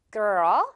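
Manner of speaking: put-on girl with the tongue lifting to L; shovel-tongue girl. Shown as a demonstration. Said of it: "삽 같은 이 혀 발음". (혀를 삽처럼 girl)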